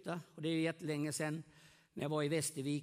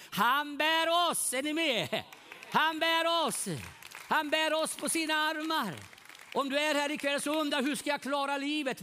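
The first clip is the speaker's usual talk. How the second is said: loud, high, slow ringing proclamation; preaching at full force; 9 semitones higher, 6 dB louder than usual. Acted. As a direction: preach it out to the whole hall, lifting each word.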